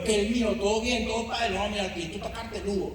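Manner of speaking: lightly put-on Venezuelan street slang voice.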